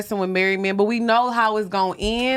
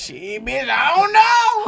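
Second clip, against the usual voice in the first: drawling friend voice; 2 semitones higher, much slower than usual. Retold or imitated. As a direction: stretch out the don't know. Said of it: "She be like".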